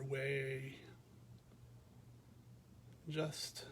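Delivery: calm, unhurried meditation voice with long pauses; guided meditation. Real, not acted. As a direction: guide listeners gently through a body scan, leaving space after each phrase. Leads a body scan meditation.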